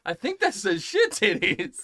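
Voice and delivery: high, loud, dawning realization; mock astonished reading. Friend asked to read the rock, mock amazed high voice. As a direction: pitch it up as a baffled friend.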